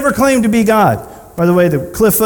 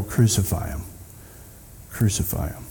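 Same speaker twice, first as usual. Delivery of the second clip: ordinary delivery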